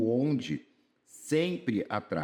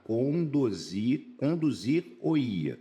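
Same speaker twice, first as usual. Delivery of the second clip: "conduzir-o-ia" spelled out slowly; slow, careful demo of a mistake; slow wrong attempt; much slower than usual. Shown as a demonstration. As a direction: draw out the wrong form syllable by syllable. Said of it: "Ainda está errado".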